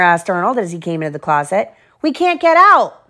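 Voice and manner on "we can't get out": put-on much higher, louder kid voice; anxious cry. A narrator's reading. Carlos calling from the trapped bus, anxious. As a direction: call out that you're stuck. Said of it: "said Carlos".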